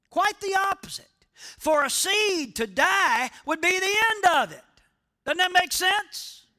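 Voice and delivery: preaching at full force. Acted. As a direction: drive the point home from the pulpit. Preaching climax.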